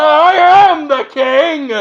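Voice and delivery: high, loud, slow royal yell; in-your-face shout. Acted. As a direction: yell it long into his face. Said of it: "get right up in his face and go".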